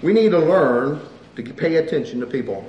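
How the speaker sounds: ordinary talk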